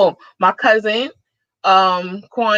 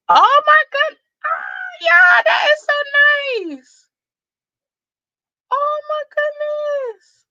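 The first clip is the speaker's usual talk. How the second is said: overwhelmed surprise; slower than usual. Real, not acted. Streamer thanking a viewer for a big gift, shrieking with joy. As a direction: squeal with shocked joy, near tears.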